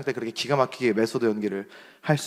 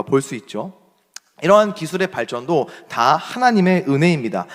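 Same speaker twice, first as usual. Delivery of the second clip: louder, earnest sermon delivery; preaching; 6 dB louder than usual. Acted. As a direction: press the message home to the congregation. Pastor preaches to congregation.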